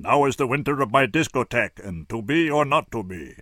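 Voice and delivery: hammed-up theatrical recital, slowing at the end; grand mock Shakespeare delivery; ham-bone Carradine. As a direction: overplay it like an old ham actor. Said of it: "in his biggest ham-bone voice".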